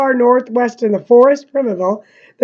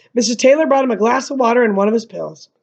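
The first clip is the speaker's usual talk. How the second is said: ordinary delivery, nothing marked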